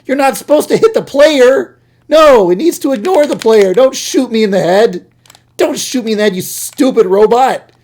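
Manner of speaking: high, loud panicked screaming at the robot; panicked yelling. Real, not acted. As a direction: yell in real panic as it shoots you. Robot shoots him in game.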